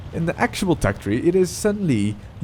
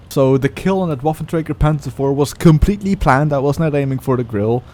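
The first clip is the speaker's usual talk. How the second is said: usual speaking voice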